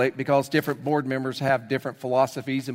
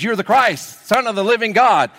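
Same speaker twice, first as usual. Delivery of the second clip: higher, louder triumphant proclamation; excited declaration; 6 semitones higher, 7 dB louder than usual. Acted. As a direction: shout it like a breakthrough. Peter's triumphant confession.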